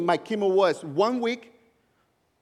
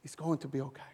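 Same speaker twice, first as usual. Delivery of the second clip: softer, gentle wife comforting her husband; soft reassurance; 5 semitones lower, 8 dB quieter, faster than usual. Retold or imitated. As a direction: hush him like a worried loved one. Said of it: "she's like".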